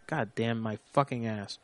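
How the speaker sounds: ordinary delivery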